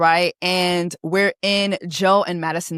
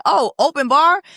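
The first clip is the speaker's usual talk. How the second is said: mock-perked-up man, pitched higher; imitating excited castmate; 6 semitones higher, 4 dB louder than usual. Recapper mimics husband hearing open bar, playful mock interest. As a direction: put on his sudden interest. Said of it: "Like, he said".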